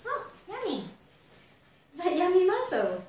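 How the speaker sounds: high, bright sing-song praise for the dog; praising the dog. Real, not acted. Trainer cooing to her dog about the muzzle, high sing-song praise.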